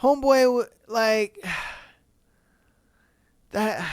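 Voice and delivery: usual speaking voice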